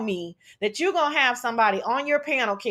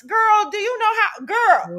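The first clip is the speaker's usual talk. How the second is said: real exasperation, pitched up; irritated; 9 semitones higher, 3 dB louder, slower than usual. Real, not acted. Venting to friends on a panel about the dropped link, irritated. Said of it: "Girl, I'm so irritated by that".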